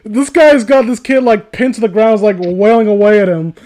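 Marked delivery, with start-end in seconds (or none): none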